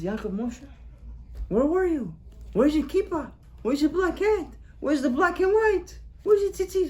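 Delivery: rising alarm, rapid questions; shocked questioning. Acted. Father at the door seeing his ragged son, growing alarm.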